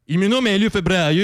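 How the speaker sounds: ordinary talk, nothing marked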